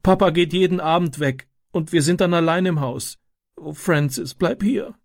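put-on high, tearful child's plea; sobbing plea. A narrator's reading. Sister begs her brother not to leave, sobbing. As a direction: beg him through tears to stay. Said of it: "schluchzte sie".

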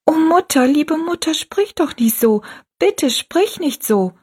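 put-on high, begging girl near tears; tearful pleading. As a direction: beg her mother to stop. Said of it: "Dann fing sie an zu weinen".

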